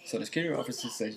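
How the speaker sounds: plain reading voice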